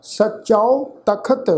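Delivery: slow ਸਚੋ ਤਖਤ, ending ਸਚੋ on "au"; ਸਚੋ ਤਖਤ slowly, with a diphthong closing ਸਚੋ; pronunciation demo. Shown as a demonstration. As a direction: draw out ਸਚੋ as "sachau" before ਤਖਤ. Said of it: "ਚੱਚੇ ਅੱਖਰ ਤੇ ਕਨੋੜੇ ਦੀ ਮਾਤਰਾ ਹੈ".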